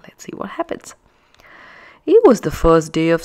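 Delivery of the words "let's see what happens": hushed teasing whisper; breathy story-time hush. Real, not acted. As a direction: whisper it like a secret. Reader teases story start.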